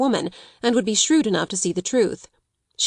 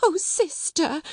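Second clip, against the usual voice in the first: high, plaintive little wail; tearful sniffing; 9 semitones higher, slower than usual. A narrator's reading. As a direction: whimper it to a sister. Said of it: "she sniffed".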